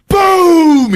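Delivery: loud, high boom; explosive imitation; blast noise. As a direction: shout the gunshot as a sound effect. Mimics gunshot blast.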